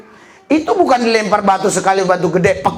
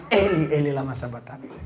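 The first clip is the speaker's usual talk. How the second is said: low, very soft, fading to almost nothing; hushed quote; 6 semitones lower, 9 dB quieter than usual. Retold or imitated. Preacher to congregation, quoting the cross cry in a fading hush. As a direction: let the cry die away under your breath.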